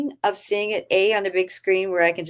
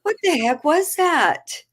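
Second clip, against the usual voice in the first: much higher, slow, stretched-out disbelief; 9 semitones higher, slower than usual. Retold or imitated. Recalling the huge salad to friends, slow baffled disbelief. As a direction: stretch it out, baffled all over again.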